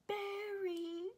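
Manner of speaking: high, wailing call out a window; child screaming the dog's name. Retold or imitated. Little boy at the window calling his lost dog, high wail.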